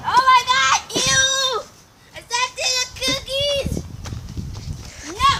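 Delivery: high, loud shriek of disgust; real panicked shout. Real, not acted. Vlogger shrieks at stepping in the cookies, loud and high. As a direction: yelp it out, really grossed out. Steps in cookies, cat nearby.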